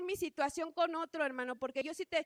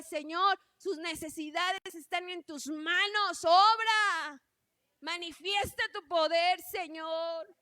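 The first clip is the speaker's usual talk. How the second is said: louder, rising to a cry on obra; fervent prayer; 2 semitones higher, 7 dB louder, slower than usual. Retold or imitated. Sisters praying for the church's needs, loud and fervent. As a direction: plead out loud to God.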